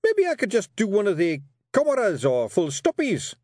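high pleading Scots Feegle voice; protesting Feegle. A narrator's reading. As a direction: plead your way out, pitched high. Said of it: "he protested".

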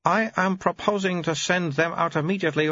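put-on very high, babyish old abbot voice; an ancient mind in a baby's mouth; high piping infant abbot; baby-bodied old abbot. Abbot gives order.

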